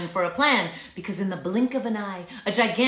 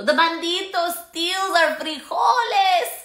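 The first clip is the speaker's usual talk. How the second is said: higher, louder, slow complaint; indignant shout; 8 semitones higher, 5 dB louder, slower than usual. Acted. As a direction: cry out the injustice. Poquito complains loudly.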